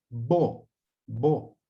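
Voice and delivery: bo repeated slowly and clearly; syllable demo. Shown as a demonstration. Teacher modelling the first syllable, spoken clearly. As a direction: spell out bo twice for the class. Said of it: "aquí tenemos la primera sílaba".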